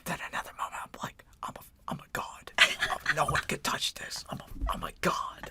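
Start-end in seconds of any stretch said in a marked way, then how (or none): 1.4-5.5 hyped self-praise, bursting louder; mock boasting. Podcaster showing his swing to self-praise, mock boasting. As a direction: brag like you're untouchable.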